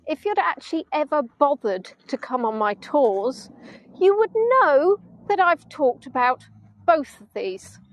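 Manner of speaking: measured telling-off in short phrases; unimpressed retort. Retold or imitated. Retorting to sister who never came on tours, unimpressed. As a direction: spell it out pointedly, pausing between phrases. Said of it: "I was not impressed".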